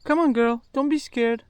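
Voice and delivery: high-pitched dog coaxing. Real, not acted.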